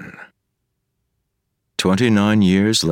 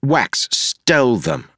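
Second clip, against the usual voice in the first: higher, slow wounded complaint; aggrieved accusation; 8 semitones higher, slower than usual. A narrator's reading. Wayne complaining to Marcy that Wax stole his boots. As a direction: tattle like a wronged kid.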